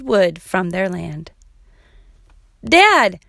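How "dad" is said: loud, high call to Dad; shouted. A narrator's reading. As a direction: call out sharply for your dad. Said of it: "she shouted".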